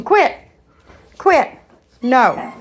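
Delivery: two loud, high barked "Quit"s; sharp commands. Real, not acted. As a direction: cut the noise off right now. Scolding the barking dog.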